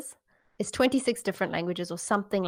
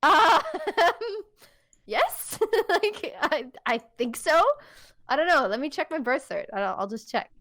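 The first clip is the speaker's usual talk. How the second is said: laughing unsure reply; 6 semitones higher than usual. Retold or imitated. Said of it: "And I was like".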